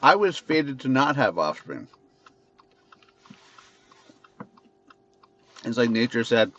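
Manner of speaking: talking around a mint; mouth full. Real, not acted. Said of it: "sorry, I got a mint in my mouth here".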